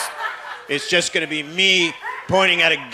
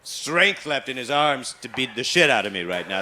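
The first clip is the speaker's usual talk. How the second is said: ordinary delivery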